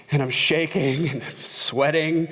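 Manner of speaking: ordinary talk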